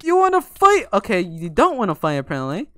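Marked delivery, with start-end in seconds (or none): 0.0-0.9 high, loud challenge; escalated taunt. Real, not acted. Gamer challenging an opponent to fight, escalated taunt. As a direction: throw the challenge out much higher and louder.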